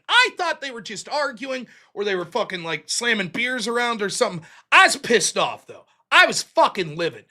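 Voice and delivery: usual speaking voice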